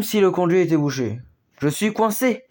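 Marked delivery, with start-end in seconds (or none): 1.6-2.4 groan. A narrator's reading. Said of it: "gémit une voix".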